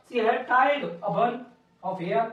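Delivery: very slow, word by word; slow dictation. Shown as a demonstration. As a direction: say it as you chalk it up. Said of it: "teacher dictates while writing". (धीरे-धीरे लिखवाते हुए)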